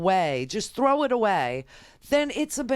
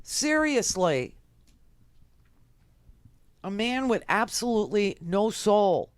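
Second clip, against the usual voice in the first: normal talking voice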